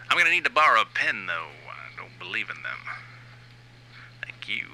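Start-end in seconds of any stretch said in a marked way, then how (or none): none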